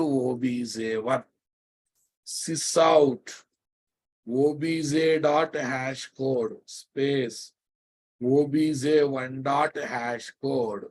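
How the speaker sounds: slow dictation. Shown as a demonstration. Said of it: "dictating code to the class".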